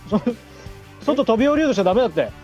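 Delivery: normal talking voice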